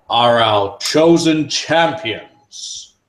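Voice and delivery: booming authoritative voice. Acted. The mantle addressing everyone, booming and authoritative. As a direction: announce it like a ruler. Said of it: "his booming authoritative voice".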